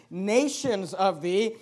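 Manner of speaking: "nations of thee" drawn out very slowly; emphasis on nations. Shown as a demonstration. Preacher stresses the promise of nations. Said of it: "notice the emphasis".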